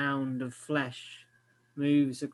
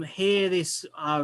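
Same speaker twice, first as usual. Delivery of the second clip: ordinary delivery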